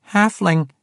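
high-pitched little squeak. A narrator's reading. Halfling at sword point corrects the swordswoman, squeaking. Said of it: "he squeaked".

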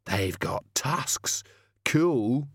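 put-on awed little boy, drawn out; small boy. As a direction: say it slowly, thrilled by the monsters. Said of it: "a small boy behind him say".

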